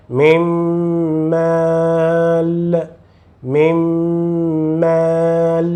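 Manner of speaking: "Mim" held with a long nasal m, then "mal"; lengthened nasal. Shown as a demonstration. Teacher demonstrates idgham with nasal: slow, held. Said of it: "faça o som nasal e alonga pouco mais".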